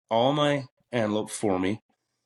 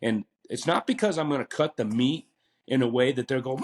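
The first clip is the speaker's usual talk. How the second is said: normal talking voice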